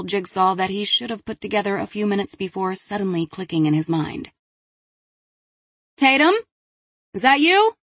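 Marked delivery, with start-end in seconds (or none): 6.0-7.8 higher, louder questioning call; calling from another room. Acted. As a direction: call out from behind a door. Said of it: "Zoe called from the bathroom again".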